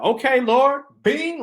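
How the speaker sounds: the light bulb just went off; thrilled gasp to God; excited realization. Retold or imitated. Epiphany moment, light bulb.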